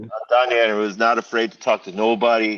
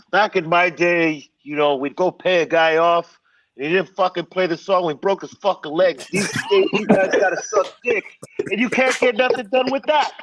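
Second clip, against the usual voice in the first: a hint of a gruff old record boss, higher; old-school exec; 6 semitones higher than usual. Donnie complaining to Jerry about radio, lightly voiced old-timer. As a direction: tease it like a veteran who's seen it all.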